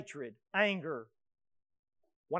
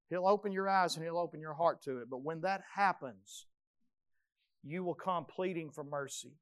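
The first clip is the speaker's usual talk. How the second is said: ordinary delivery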